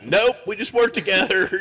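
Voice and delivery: loud, high mock protest; emphatic denial. Coworkers answer whether they're a couple, loudly denying it. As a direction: blurt it out loudly over laughter.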